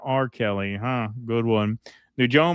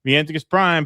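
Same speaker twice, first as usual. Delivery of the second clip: ordinary talk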